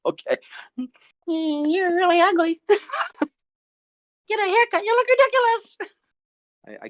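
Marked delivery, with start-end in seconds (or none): 1.3-2.6 slow, very high chimp puppet insult; squeaky-high cheeky young chimp; Johnny. Acted. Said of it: "Johnny, are you gonna be good?". 2.7-5.8 very high chimp puppet talking back; squeaky-high cheeky young chimp; Johnny. Acted. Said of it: "Johnny, are you gonna be good?".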